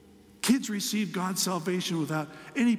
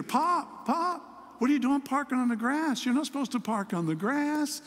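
put-on much higher child voice, shocked; indignant little grandson; 7 semitones higher than usual. Grandson telling his pop not to park on the grass, high indignant child voice. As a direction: voice the boy protesting the parking on grass. Grandson scolding about parking.

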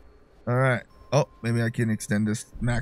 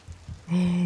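startled gasp; 5 semitones higher, 4 dB quieter than usual. Real, not acted. Startled by game.

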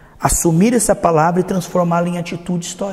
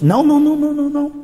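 high-pitched string of no's; emphatic rejection; 8 semitones higher, much faster than usual. Acted. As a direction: repeat the no's high, on one held pitch. Preacher emphatically rejects the false claim.

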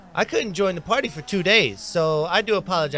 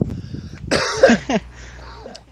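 coughing fit; 6 semitones higher than usual. Real, not acted. Coughing after spitting drink.

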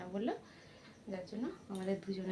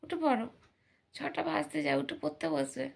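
raised, higher voice nudging him out of bed; urging call; 6 semitones higher, 5 dB louder than usual. Real, not acted. Mother urging son to get up. (ছেলেকে উঁচু গলায় তাড়া দেওয়া)

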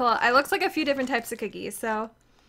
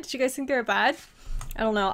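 usual speaking voice

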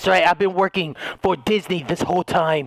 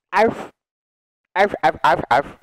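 short imitation barks; dog barking. Joker playing a dog, mock barking. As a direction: yap out a little dog impression.

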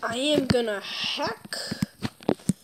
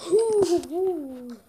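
excited whispered ooh; 5 semitones higher, 6 dB louder than usual. Real, not acted.

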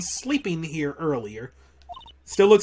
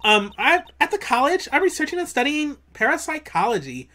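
put-on high, lilting Valley Girl; ditzy Valley Girl chatter; 7 semitones higher than usual. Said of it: "I gotta do a Valley Girl voice".

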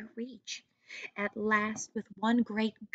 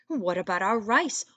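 whining; 6 dB louder than usual. Acted. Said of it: "whined the second son".

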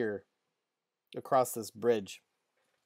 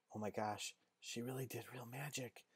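very soft, near-whispered amazement; hushed awe; 18 dB quieter, faster than usual. Acted. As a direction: confide it to a friend under your breath. Girls murmur to each other, awed.

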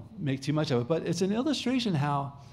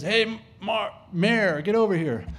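drill sergeant; 4 semitones higher, 4 dB louder than usual. Retold or imitated. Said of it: "the sergeant says".

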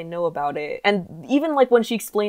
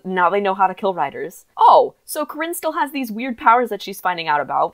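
ordinary talk, nothing marked

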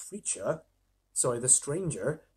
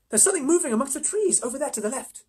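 put-on high-pitched girl pointing something out; Susan's girlish voice; 10 semitones higher than usual. A narrator's reading. Susan shows the others movement in the trees, high girlish voice. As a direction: lift it into a young girl's voice.

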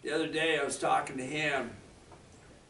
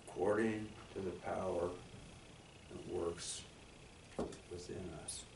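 solemn scripture reading; 5 semitones lower, 10 dB quieter than usual. Acted. Reading scripture verse aloud.

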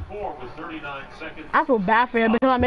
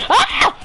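sharp yelp; 11 semitones higher, 5 dB louder than usual. Real, not acted. Sudden yelp mid-fight.

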